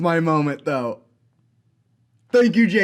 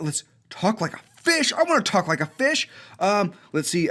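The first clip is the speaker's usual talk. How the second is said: ordinary talk, nothing marked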